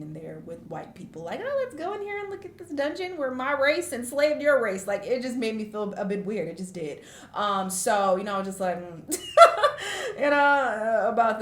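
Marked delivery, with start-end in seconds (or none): 1.4-4.9 higher-pitched mock tour invitation; put-on sing-out of an awkward suggestion. Imagined awkward tourist invitation, acted out. 9.2-10.1 loud, high burst of laughter; real high-pitched laugh; awkward laugh. Real, not acted. Laughs at own awkward feeling.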